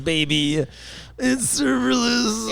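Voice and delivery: high, slow, drawn-out developer voice; mock a clueless developer. Mocking a developer's excuse.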